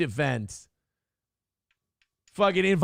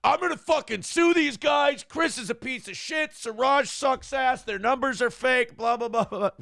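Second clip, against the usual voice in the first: ranting; 6 semitones higher than usual. Retold or imitated.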